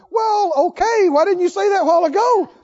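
high-pitched, hurried comic indignation; exasperated retort. Acted. Preacher answering the friends who finally yielded, exasperated retort. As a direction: throw their okay back at them, high and impatient.

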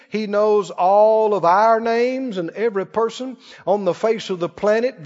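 usual speaking voice

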